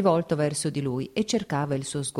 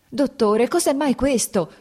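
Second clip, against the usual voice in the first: panicked cry; 7 semitones higher, 5 dB louder than usual. A narrator's reading. Lievin panicking, grabbing doctor.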